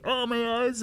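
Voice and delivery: very high wail of pain; mock anguished wail. Cook retelling rubbing habanero in his eye, wailing. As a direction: cry out as the pepper hits your eye.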